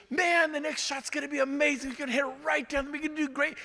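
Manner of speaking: wife cheering his golf shots, cheery imitation; imitating wife. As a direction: mimic his upbeat wife. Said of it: "and she goes".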